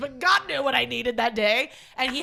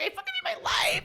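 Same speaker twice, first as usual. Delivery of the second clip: strained high squeal. Retold or imitated. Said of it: "I'm like".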